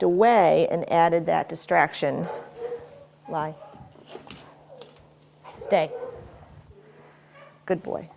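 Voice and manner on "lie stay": ordinary talk, nothing marked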